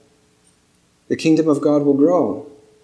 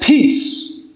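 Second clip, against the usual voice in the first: commanding peace; 8 semitones higher than usual. Acted. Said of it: "he spoke to the raging waters".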